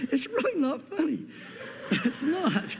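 giggling apology, pitch way up; fighting back laughter; trying not to laugh. Real, not acted. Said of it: "I'm trying not to laugh".

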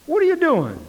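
high, loud incredulous question; acted exasperated disbelief. God catching His people griping, loudly incredulous. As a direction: cry it out in mock astonishment.

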